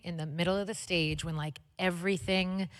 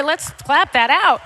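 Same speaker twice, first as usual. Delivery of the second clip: excited; 9 semitones higher, 11 dB louder, slower than usual. Real, not acted. Cheering for nephew.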